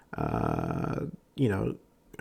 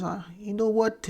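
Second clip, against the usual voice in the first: tennis player quote; 10 semitones higher, 4 dB quieter than usual. Retold or imitated. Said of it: "He just goes".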